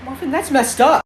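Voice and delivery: high, loud disgusted cry; appalled shout. Acted. Friend appalled by Marvin's grim thought, loud outburst. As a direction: yell his name, horrified.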